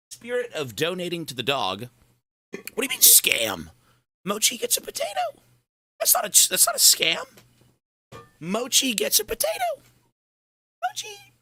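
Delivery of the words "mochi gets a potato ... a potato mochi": much higher sing-song pet voice; high squeaky pet talk. Pretend. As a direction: coo at the dog like a baby.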